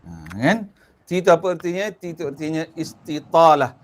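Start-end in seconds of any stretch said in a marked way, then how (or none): none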